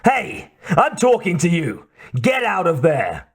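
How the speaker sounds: mild yell. A narrator's reading. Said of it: "the Mercedes driver yelled".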